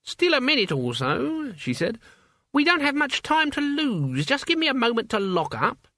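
put-on high, crackly old woman; old witch voice. A narrator's reading. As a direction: play an elderly witch, brisk. Witch voice in narration.

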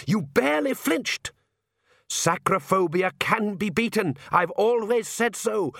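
high, drawn-out triumphant delivery; excited. A narrator's reading. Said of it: "said the Count excitedly".

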